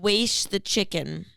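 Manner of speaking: "Wash" said slowly as waysh; imitated mispronunciation. Listener trying out the odd word, "Wash" drawn out as waysh. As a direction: stretch "Wash" into waysh, with an ay vowel. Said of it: "he was saying weish".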